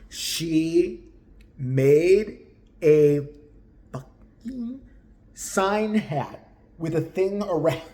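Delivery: slow, word by word, punching made and a; emphatic reveal. Real, not acted. Narrator telling viewers about the sign hat, slow emphatic build. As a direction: spell out the absurdity.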